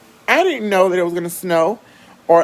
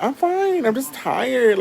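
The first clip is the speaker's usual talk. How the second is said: high-pitched brush-off; 8 semitones higher, slower than usual. Retold or imitated. Sick speaker answering worried people, high-pitched brush-off. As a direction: pitch leaps up in protest.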